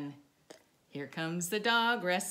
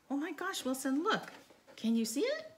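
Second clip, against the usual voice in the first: excited gasp; 10 semitones higher than usual. Acted. Showing picture to dog.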